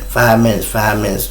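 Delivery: ordinary delivery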